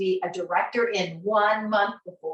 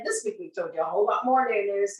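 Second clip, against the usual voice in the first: usual speaking voice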